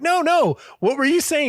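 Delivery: high-pitched, pressing interviewer; imitated eager interviewer. Interviewer presses chef for the gossip, high and eager. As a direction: put on a high, coaxing voice.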